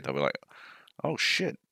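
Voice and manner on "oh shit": soft, higher mock American accent. Podcaster imitating baffled Americans, half-hearted American accent. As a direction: attempt an American accent, quiet and high. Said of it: "I can't do any more American accent".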